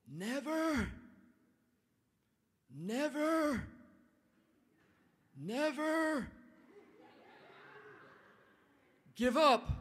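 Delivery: put-on an old statesman thundering his whole speech; Churchill's rallying cry. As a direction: boom each never out to the crowd like a wartime leader. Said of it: "and he says this".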